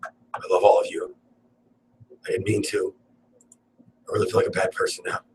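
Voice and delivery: hushed, half-whispered fake apology; quiet put-on remorse to the audience; sheepish mock apology. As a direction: sheepishly take it back under your breath. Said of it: "You're like".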